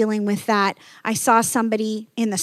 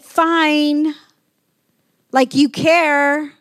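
bitter retort; 7 semitones higher, 7 dB louder, much slower than usual. Retold or imitated. Bitter homeless man retort.

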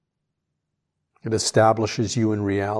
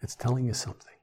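whisper; 5 dB quieter, faster than usual. Real, not acted. Guided reflection, hushed repetition.

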